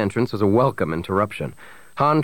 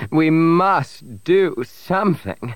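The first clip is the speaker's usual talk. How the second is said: slow, high tearful wail; mother's anguished, high-pitched plea; crying; 8 semitones higher, much slower than usual. A narrator's reading. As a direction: drag each word out plaintively.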